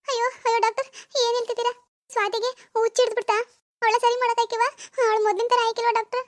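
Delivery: high-pitched anxious mother pleading with the doctor; a frightened mother begging for answers; worried mother. Acted. Mother voiced beside doctor.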